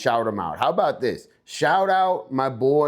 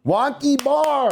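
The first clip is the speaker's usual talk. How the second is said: excited; 12 semitones higher, 3 dB louder than usual. Real, not acted. Hyping the winning strain name.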